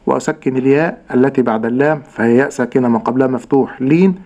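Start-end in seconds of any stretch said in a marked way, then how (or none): none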